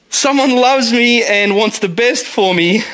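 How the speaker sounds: slowed, lingering on love; slow tender realisation. Real, not acted. Husband realising wife's care, slow and tender.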